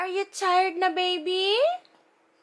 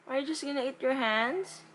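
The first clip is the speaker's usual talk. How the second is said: usual speaking voice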